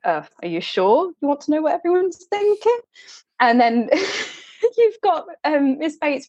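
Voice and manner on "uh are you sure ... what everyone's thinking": a hint of a reserved gentleman, pitched higher; lightly voiced reserved man, a bit higher; reserved Knightley. Retelling the reserved Knightley's question at the game, lightly voiced higher. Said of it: "who's very reserved again, is like".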